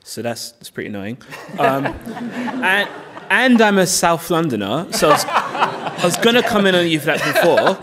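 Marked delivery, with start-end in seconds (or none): 1.6-7.8 chuckling while talking, a bit louder; laughing. Real, not acted. Panelist teases the speaker about South London, laughing. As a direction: tease him through a laugh.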